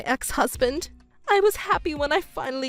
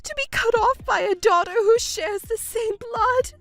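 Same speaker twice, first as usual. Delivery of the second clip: wailing mother; 8 semitones higher than usual. Acted. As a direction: wail in self-pity, voice rising. Mother wailing over lost daughter.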